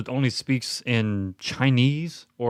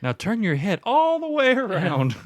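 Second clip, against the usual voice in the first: mock instructor; 10 semitones higher than usual. Mock exorcism workout instruction.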